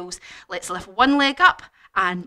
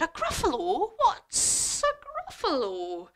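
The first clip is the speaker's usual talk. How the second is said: slinky pitched-up snake voice; puzzled snake; 9 semitones higher than usual. Acted. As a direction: draw out the s like a snake. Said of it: "And the snake said".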